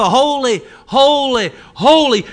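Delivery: loud rising cries of holy; seraphs crying out. Acted. Seraphs calling holy to one another, loud cry. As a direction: call it out like angels.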